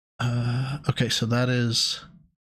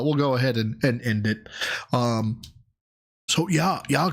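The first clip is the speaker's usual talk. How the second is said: normal talking voice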